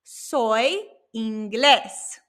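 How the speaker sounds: very drawn-out "Soy inglés"; slow Spanish model. Shown as a demonstration. Teacher showing how to say I am English, very slow. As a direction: give "Soy inglés" word by word, slowly. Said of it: "the way we can say".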